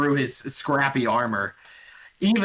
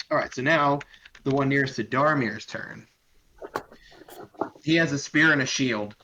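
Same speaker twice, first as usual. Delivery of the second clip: normal talking voice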